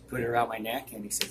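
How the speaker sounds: usual speaking voice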